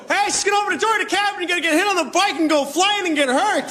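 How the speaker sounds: high, laughing rush of words; rushed mock warning. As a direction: cram a whole warning into one breath. Overlong warning he couldn't yell.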